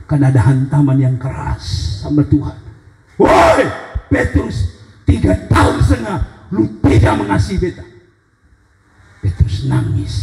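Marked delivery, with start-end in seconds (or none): 3.2-7.9 confronting rebuke. Acted. Tuhan confronting Petrus after three years, high rebuke. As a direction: hail him sharply and accuse him, pitched up.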